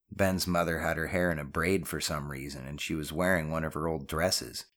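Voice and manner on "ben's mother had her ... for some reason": usual speaking voice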